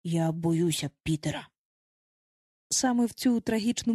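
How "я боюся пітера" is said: low, subdued admission of fear; trembling, fearful. A narrator's reading. Bazika admits fearing Peter, trembling and low. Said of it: "увесь тремтячи".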